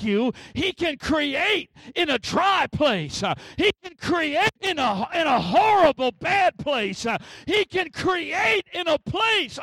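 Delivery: high, impassioned pulpit cadence; full-force preaching. Real, not acted. Preacher declaring that God creates in dry places, fervent preaching. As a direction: climb into sustained, ringing proclamation.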